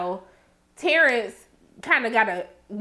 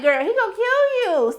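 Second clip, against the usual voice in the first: mock alarm; 10 semitones higher than usual. Acts out warning Roulette.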